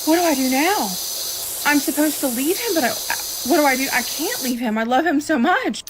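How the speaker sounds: high, distressed re-enactment of helplessness; anguished dilemma. Retold or imitated. Woman re-enacting not being able to leave the man she loves, anguished.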